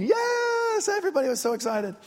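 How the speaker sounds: hammed-up cheer, rushed words; mock excitement. Mock cheering over campaign.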